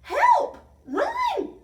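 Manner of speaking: very high, loud scream for help; panicked shriek. A narrator's reading. Tiny and gang fleeing the monster, shrieking. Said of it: "shrieked Tiny and his gang".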